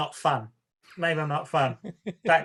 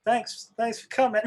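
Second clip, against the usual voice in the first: higher, bright mock fan greeting the director; 8 semitones higher than usual. Audience member gushing to the director at the screening, mock cheerful. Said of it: "everyone's applauding and going".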